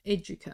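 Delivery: educa with English j sound, ED-joo-kah; like education. Shown as a demonstration. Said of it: "like education".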